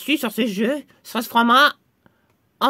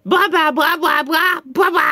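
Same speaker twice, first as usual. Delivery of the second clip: higher rapid chanted nonsense; magic formula; 5 semitones higher, 4 dB louder, slower than usual. Acted. Redoing the formula to undo the swap, chanted incantation. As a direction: chant it as a spell. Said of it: "on va recommencer la formule".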